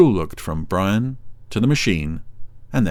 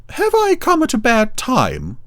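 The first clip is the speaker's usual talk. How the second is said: very high, slow cow voice; campy drag queen; 3 dB louder than usual. A narrator's reading. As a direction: drawl it sweetly and high. Ermintrude voiced again.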